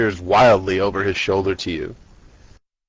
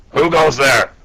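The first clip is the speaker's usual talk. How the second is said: lightly gravelly challenge, a touch louder; low gravel vigilante growl; 4 semitones higher, 5 dB louder than usual. Acted. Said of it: "in a really gravelly voice".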